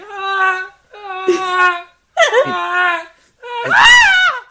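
rising sobbing cries ending in a shout; fake sobbing wails. Mock crying after Big Kitty.

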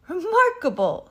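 high, loud exclamation; snorting horse. A narrator's reading. Horse reacts to Henry's map, snorting. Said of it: "snorted Mister Brown".

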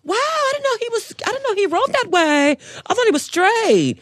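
high, exaggerated gossipy girl; mock gossip voice. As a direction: squeal it like a nosy friend.

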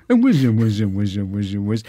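repeated buzzing mock whiz. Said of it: "[name] writing a letter".

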